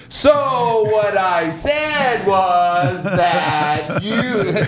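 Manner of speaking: very slow, drawn-out mock explaining; exaggerated loud, laboured delivery. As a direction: stretch every word as if to a non-English speaker. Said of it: "start talking louder and slower".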